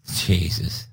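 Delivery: normal talking voice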